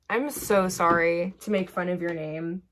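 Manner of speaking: usual speaking voice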